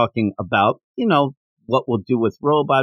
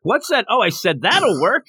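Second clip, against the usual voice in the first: high, mock-innocent backtrack; innocent cover-up; 8 semitones higher than usual. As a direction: play innocent after a sneaky insult. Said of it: "and then change it".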